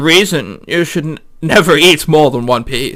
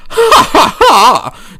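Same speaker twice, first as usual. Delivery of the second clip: high, slow mock villain laugh; slow, high theatrical villain ha ha ha; gloating laugh; 10 semitones higher, much slower than usual.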